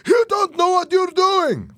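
very high, loud frantic yell; shouting. A narrator's reading. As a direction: shout in alarmed anger. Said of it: "Fernando shouted".

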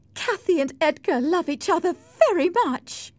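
high-pitched emotional interjection; upset young wife. Acted. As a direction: protest in a high, strained cry. Isabella breaks into the argument.

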